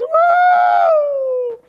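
high, loud whoop of pure joy; joyful whoop. Acted. Prodigal son looks at his dad, high joyful whoop. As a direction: let out a shout of joy.

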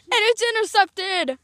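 loud, drawn-out commentator cry; excited play call. Pretend. Announcer tells viewers of an interception, loud excited call. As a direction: call the turnover loudly, stretching it.

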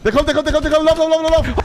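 high loud panicked urging. Real, not acted. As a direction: yell run in a panic. Monster coming, panicked.

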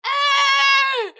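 loud high scream of despair; anguished scream. Acted. Wife rejected at the gate.

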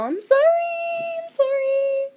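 very high, drawn-out sorry; squeaky apology. Real, not acted. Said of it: "I'm stuffy and all nasally".

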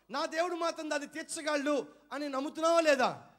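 loud, high, driving sermon voice; full-force revival preacher; fervent preaching. Acted. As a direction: press the question on the congregation.

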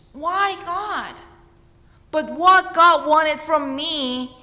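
ordinary talk

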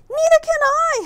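very high, loud frightened howl. A narrator's reading. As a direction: howl in panic mid-flight. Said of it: "howled Flitter".

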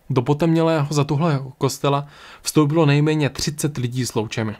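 ordinary delivery

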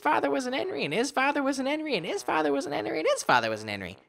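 put-on high, rustic old hermit. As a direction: play the chatty hermit with a country twang. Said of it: "I'm glad you like the hermit voice".

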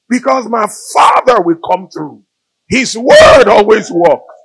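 loud, slow preaching proclamation; preaching shout. Real, not acted. Preacher to the congregation, slow and loud. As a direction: proclaim it, draw out every word.

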